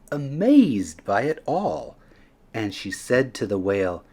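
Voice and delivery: usual speaking voice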